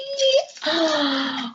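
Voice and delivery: high soft gasp of suspense. A narrator's reading. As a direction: gasp as if afraid to look.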